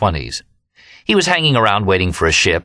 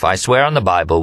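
plain reading voice

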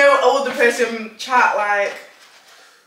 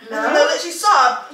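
ordinary talk, nothing marked

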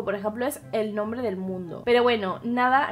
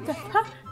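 quick high giggle at her own stumble; short laugh; 8 semitones higher than usual. Real, not acted. Laughing at herself after mixing up words, a quick high giggle. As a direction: laugh off the tongue slip.

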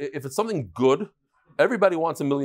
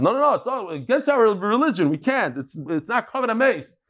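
higher-pitched, hurried objection; mock protest; 7 semitones higher than usual. Smuggler to border guard over coffin, mock protest. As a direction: act out a smuggler objecting urgently.

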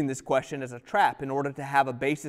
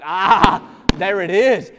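knowing triumph; 4 semitones higher, 12 dB louder, much slower than usual. Retold or imitated. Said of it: "And I'm like".

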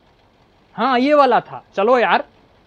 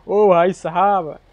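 drawn-out amazed groan; 3 semitones lower, much slower than usual. Real, not acted. Stunned at crushed trailer.

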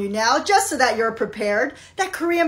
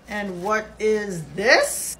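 slow suspicious officer; 5 semitones lower, 3 dB quieter, much slower than usual. Acted. Officer finds item.

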